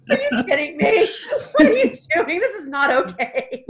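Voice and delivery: high, loud mock-outraged yell with laughter; yelling at him. Mother telling off her returned son, playful outraged yelling. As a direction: scold at full pitch, half laughing. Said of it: "like, yelling at him".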